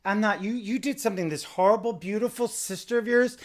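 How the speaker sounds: loud, high mock scold; hammed-up high scolding of Kathy; exaggerated shouting Lisa impression. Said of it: "she's like".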